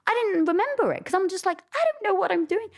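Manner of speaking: high, flustered cry of helplessness; mock panic. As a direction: squeak it out like you've lost your lines. Retelling panic at the camera.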